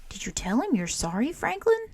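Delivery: lightly voiced Snail, asking kindly, last word rising high; gentle Snail. A narrator's reading.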